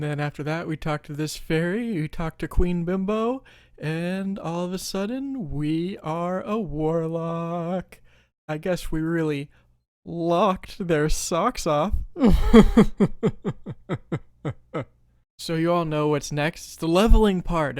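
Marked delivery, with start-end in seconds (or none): 3.8-8.0 very slow, drawn-out announcement; dramatic reveal. Acted. Player revealing the new warlock class, drawn out. 10.0-11.9 very slow, stretched pun delivery; milk the pun, stretching every word; drawn-out punchline. Acted. Player landing a warlock pun, drawn out. 12.0-14.2 loud, high laugh trailing on; long laugh. Acted. Player amused by own warlock pun, laughing.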